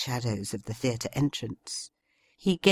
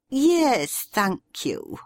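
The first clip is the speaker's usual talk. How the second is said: irritated; 9 semitones higher, much slower than usual. A narrator's reading. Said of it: "interrupted Karina, irritated".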